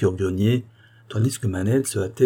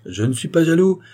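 raised, high-pitched indignation; angry protest; 6 semitones higher, 5 dB louder than usual. A narrator's reading. Visitor striking his stick at the girl's feet, indignant outburst. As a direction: lay down the law, heated. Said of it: "en frappant le sol de son bâton".

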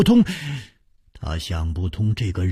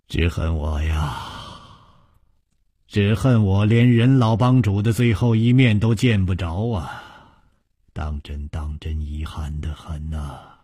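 a regretful sigh of a line, fading out; rueful regret; 7 semitones lower than usual. A narrator's reading. Chu Liuxiang voices regret to Madam Ren, fading to a whisper. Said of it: "楚留香长笑说".

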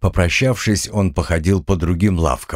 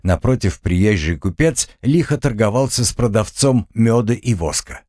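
usual speaking voice

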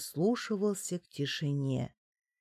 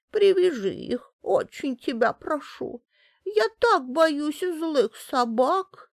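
toothless mumble; 8 semitones higher, 3 dB louder than usual. A narrator's reading. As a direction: plead in a trembling old hag voice. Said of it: "дрожащим голосом прошамкала старушонка". (дрожащий шамкающий голос старухи)

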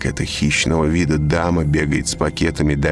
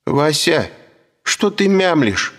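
shrill scolding wife; 14 semitones higher, 4 dB louder than usual. A narrator's reading.

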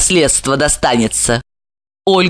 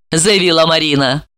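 plain reading voice

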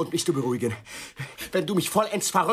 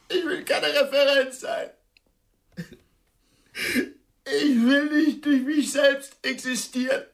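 pathetic; 3 semitones higher than usual. Acted. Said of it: "nicht ganz so pathetisch".

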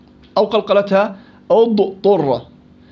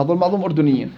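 ordinary talk

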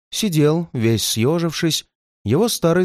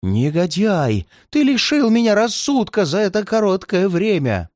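put-on reproachful old man; 7 semitones higher than usual. A narrator's reading. Old man accuses the redhead, high plaintive outburst. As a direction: cry out at the villain in a high voice.

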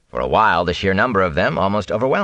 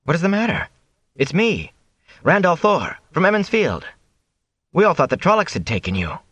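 put-on higher-pitched earnest young man; Rand, concerned; 10 semitones higher than usual. Rand reassures the frightened peddler who he is, concerned. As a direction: plead gently in a high, lifted voice. Young hero's voice in narrated dialogue.

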